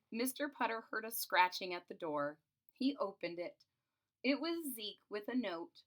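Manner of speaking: usual speaking voice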